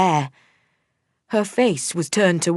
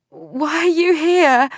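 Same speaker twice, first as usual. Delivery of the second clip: put-on higher, louder slow dazed girl; 11 semitones higher, 4 dB louder, slower than usual. A narrator's reading.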